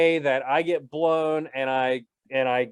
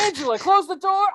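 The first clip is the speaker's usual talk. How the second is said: mock shout. Acting caught mid-sex, shouting.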